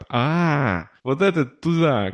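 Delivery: ordinary talk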